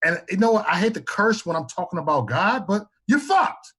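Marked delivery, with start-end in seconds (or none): 2.7-3.7 drawn out slow and much higher; emphatic verdict. Real, not acted. Warning listeners about doom, slow emphatic punchline. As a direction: lean hard into the blunt verdict. Blunt warning after holding back curse.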